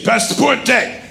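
mimicked soldier, pitched high; soldier demanding passports. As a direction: make it a high, insistent checkpoint demand. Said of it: "and they say".

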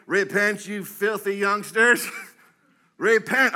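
lightly hammed-up street-preacher call; megaphone street preacher. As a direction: imitate a scolding protester with a megaphone. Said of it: "with these megaphones saying".

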